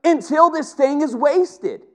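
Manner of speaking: high, loud preacher's cry; fiery pulpit climax; impassioned preaching. Acted. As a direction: preach it at full force, voice up high. Preaching at full force.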